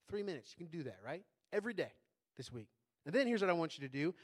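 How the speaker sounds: ordinary talk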